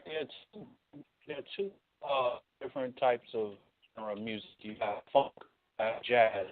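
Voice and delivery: caller breaking up on the line; a faint, choppy phone line; over the phone. Real, not acted. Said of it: "You're breaking up".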